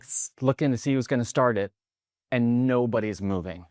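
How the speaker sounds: ordinary talk, nothing marked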